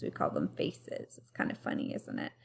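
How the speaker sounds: hushed, playful aside to the class; whispered aside. Acted. Teacher to pupils, amused at the name faces, whispering. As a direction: whisper it like a little secret.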